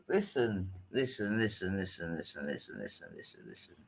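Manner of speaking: low repeats shrinking to almost nothing; fading repetition. Real, not acted. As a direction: let it sink away to a tiny mumble. Repeats one word, fading out.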